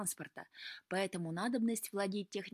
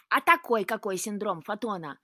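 loud, testy throwing-back of words; disgruntled retort; 9 dB louder than usual. A narrator's reading. Cat answering Luka's question, disgruntled. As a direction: grumble it back at full volume. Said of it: "недовольно буркнул кот".

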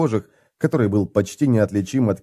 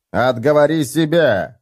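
barking; 5 semitones higher, slower than usual. Acted. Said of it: "рявкнул Хамнер".

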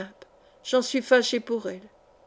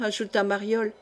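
ordinary delivery

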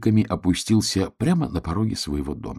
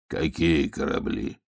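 put-on low, slow, softer old wolf; puzzled old wolf; 6 semitones lower, 6 dB quieter, slower than usual. Old wolf questions hedgehog, low and slow. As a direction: ask slowly, deep and unhurried.

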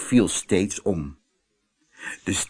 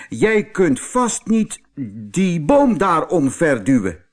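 bull taunting; 7 semitones higher, 4 dB louder than usual. Acted. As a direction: drag out the dare as the bull. Bull dares the mouse.

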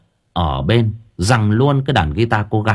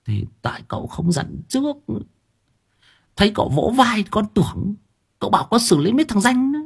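higher, soft breathless servant voice; panting servant; 11 semitones higher, 4 dB quieter than usual. Acted. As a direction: puff out the excuse. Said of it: "thở hổn hển". (giọng cao, thở hổn hển)

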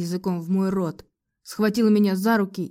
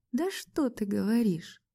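soft, slow, sarcastic question; quietly mocking, drawn out; venomous; 2 semitones higher, 5 dB quieter than usual. A narrator's reading. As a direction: feign wonder with poisonous sweetness. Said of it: "ядовито улыбнулась я".

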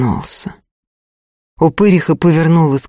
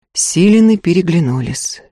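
plain reading voice

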